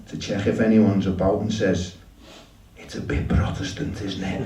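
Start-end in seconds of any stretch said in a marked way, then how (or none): 2.8-4.5 hushed aside, barely voiced; confidential whisper. Woman checking nobody's about before confiding, confidential whisper. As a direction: whisper it so nobody else hears.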